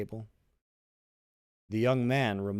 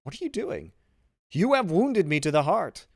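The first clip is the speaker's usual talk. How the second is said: high, rising to a loud cry; an understated cry of a wounded heart; pained outcry; 8 semitones higher, 7 dB louder than usual. A narrator's reading. Son kneels, father is wounded.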